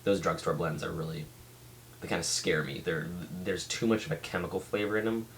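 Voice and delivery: ordinary talk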